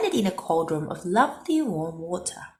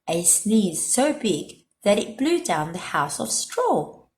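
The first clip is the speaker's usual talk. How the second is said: ordinary delivery, nothing marked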